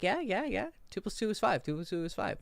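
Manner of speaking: high, mock-innocent insistence; imitated blatant liar. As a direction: mimic someone lying to your face. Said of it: "telling you a blatant lie".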